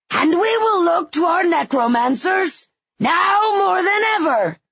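high, loud rallying call. A narrator's reading. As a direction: proclaim it to the crowd. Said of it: "raising his raspy voice".

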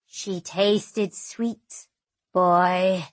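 slow, hushed, relishing cruelty; menacing djinn voice. Acted. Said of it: "he says".

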